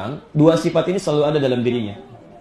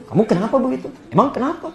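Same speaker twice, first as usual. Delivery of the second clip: higher-pitched, mock-huffy questioning; huffy retort; 7 semitones higher, faster than usual. Husband answering a sulking wife, huffy. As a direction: act out a touchy spouse answering back. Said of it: "Anda misalnya sewot".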